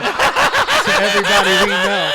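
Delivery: high, loud burst of laughter; howling with laughter; laughing hard. Real, not acted. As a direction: laugh out the end of the line. Cracking up at own joke.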